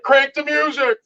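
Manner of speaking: a hammed-up protester lady; mock protester lady. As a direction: play the shrill-voiced heckler giving a command. Voicing the lady among protesters.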